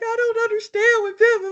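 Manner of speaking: a hammed-up tearful wail; mock crying. Mimicking her own tearful confusion to her friend, mock crying. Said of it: "And I'm more like crying".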